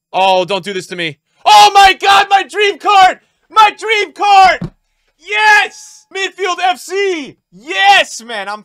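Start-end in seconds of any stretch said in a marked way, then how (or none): 1.5-8.2 high, loud victory yells; ecstatic screaming. Real, not acted. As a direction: yell like a dream came true. Gets his dream card.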